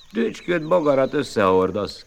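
high, loud jeering cry; mocking shout. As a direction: shout him down as a liar. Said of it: "kiáltották". (gúnyos kiáltás)

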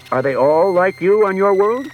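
agitated. A narrator's reading. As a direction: press the question nervously. Bailey's agitated question.

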